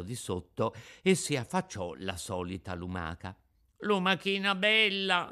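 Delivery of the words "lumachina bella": much higher, coaxing shout; shouting up to the snail's window. Acted. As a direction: call up sweetly from the street. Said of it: "gridò Pinocchio dalla strada".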